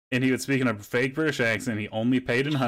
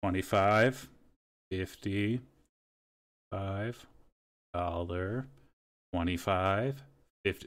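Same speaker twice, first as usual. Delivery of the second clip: slow coin counting; 3 semitones lower, 6 dB quieter, slower than usual. Retold or imitated. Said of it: "they counted".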